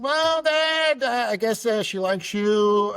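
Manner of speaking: high-pitched, drawn-out mock astonishment; awkward surprise. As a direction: react taken aback, voice jumping up, hesitant. Son reacting to dad's kiss.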